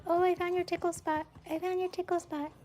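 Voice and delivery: high cooing tickle voice. Real, not acted. Breeder cooing high while tickling the puppy.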